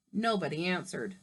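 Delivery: plain reading voice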